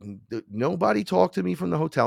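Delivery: normal talking voice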